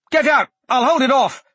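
high, loud alarmed yelling; panicked shout. Acted. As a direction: shout a frantic warning. Facing beast with sword drawn.

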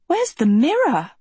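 high gasp of alarm. A narrator's reading. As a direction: gasp it out, pitch leaping up. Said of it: "she gasped".

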